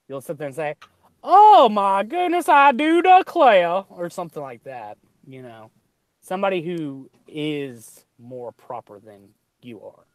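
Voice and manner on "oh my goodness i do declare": very high, loud, slow mock Southern drawl; a much higher, slow Southern drawl mocking a Tennessee speaker; mocking Southern accent. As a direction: poke fun at a proper Southern lady. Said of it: "wanting to poke fun at them".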